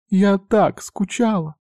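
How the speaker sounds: put-on high, drawn-out girlish gushing; affectionate excitement. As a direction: gush it slowly in a high voice. Sister hugs brother she missed. (восторженно, высоко и протяжно)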